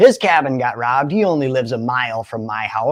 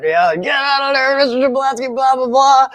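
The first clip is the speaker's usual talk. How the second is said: mock cop yell; 10 semitones higher, 4 dB louder than usual. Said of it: "he starts screaming".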